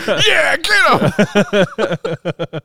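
loud, high-pitched hollered cheer; rowdy cheering. Retold or imitated. Viewer cheering Paul on, rowdy mock shout. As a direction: egg the hero on from the seats.

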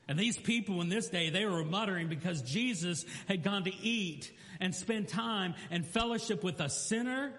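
high, ringing sermon delivery; preaching. Acted. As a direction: build it up from the pulpit. Fervent preaching passage.

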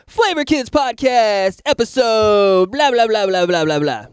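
high, slow announcer voice; hammed-up show intro; imitating a podcast host opening the show; mock radio host intro. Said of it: "come back to be like".

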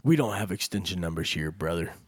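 mock deep scammer.

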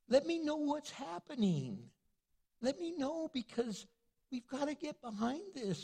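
ordinary talk, nothing marked

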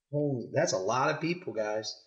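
ordinary delivery

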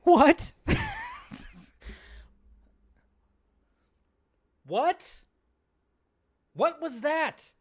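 real disbelief. Real, not acted. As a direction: react in shocked disbelief. Stunned by the lawyer's line.

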